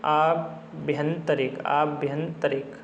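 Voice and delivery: आभ्यंतरिक drawn out syllable by syllable, aa-bhyan-ta-rik, slowly; slow spelling-out. Shown as a demonstration. Teacher to students drilling the word, very slow and deliberate. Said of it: "इसलिए हो जाएगा यहाँ आभ्यंतरिक".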